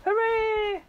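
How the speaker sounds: loud, high joyful cheer; shouted. A narrator's reading. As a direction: cheer it out triumphantly.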